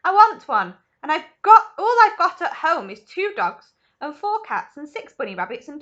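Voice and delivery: loud, high tantrum rant; shouting spoilt child; bratty shouting. Acted. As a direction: rattle off the list like a spoilt brat. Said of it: "shouted Veruca".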